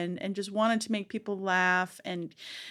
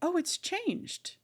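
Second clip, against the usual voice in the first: high, slowed amazement; surprised; 10 semitones higher than usual. Retold or imitated. Returning to find the space expanded, surprised and high. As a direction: marvel at the change, high. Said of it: "really surprised".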